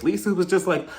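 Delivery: ordinary talk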